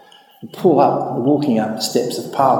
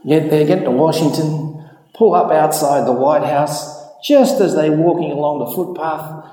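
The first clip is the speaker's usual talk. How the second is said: ordinary talk, nothing marked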